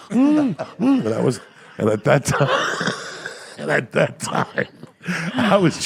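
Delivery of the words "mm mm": high-pitched muffled humming; imitated muffled moans. Comic imitating the startled woman, muffled high moans. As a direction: mimic surprised noises through a full mouth. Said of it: "She's like".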